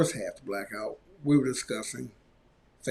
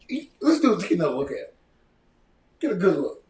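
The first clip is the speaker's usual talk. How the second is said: ordinary talk, nothing marked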